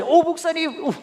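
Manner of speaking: high, loud sweet offer; eager staffer. Retold or imitated. Staffer offering the pastor a ride, high eager voice. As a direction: chirp the offer brightly upward.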